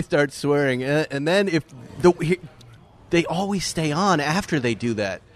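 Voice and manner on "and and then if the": ordinary talk, nothing marked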